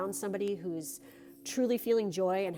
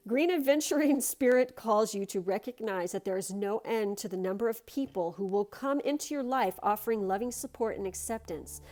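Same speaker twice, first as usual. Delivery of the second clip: card reading; 3 semitones higher, 5 dB louder than usual. Acted. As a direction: read it like a gentle blessing. Reads the card text.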